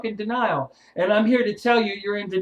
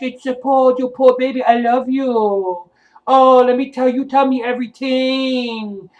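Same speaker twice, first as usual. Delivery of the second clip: mock a gushing therapist soothing a crybaby; mock coddling; 4 semitones higher, 5 dB louder than usual. Mocking the therapist he refuses to be, sugary and high. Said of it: "handle you with kid gloves".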